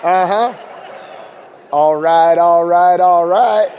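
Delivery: a lazy, cool, repeated catchphrase; preacher hamming up a famous actor's catchphrase, loud and drawled; Matthew McConaughey impression. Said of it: "Matthew McConaughey".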